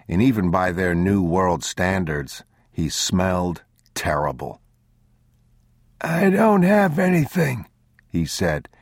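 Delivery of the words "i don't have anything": put-on high-pitched old traveler; wary old man. A narrator's reading. Old traveler to strangers he takes for robbers, high old-man voice. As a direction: call out high, defending yourself.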